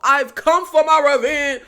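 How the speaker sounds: loud, pompous mock hero voice; Apollo. Reviewer mocking the hero's line, hammed-up proclaiming. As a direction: declaim it grandly, poking fun. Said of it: "who talks like that?".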